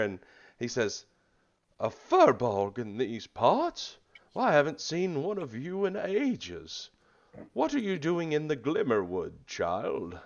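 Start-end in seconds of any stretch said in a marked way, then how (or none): 1.8-10.2 put-on high, loud, slow-starting old-world turtle voice; surprised turtle. Turtle druid meets a furbolg in his woods, astonished creature voice. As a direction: marvel at the visitor in a swooping creature voice. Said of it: "It's a good turtle".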